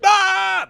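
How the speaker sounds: very high, loud acted scream; imitated scream. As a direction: scream as if bitten on the neck. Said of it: "And I just start screaming".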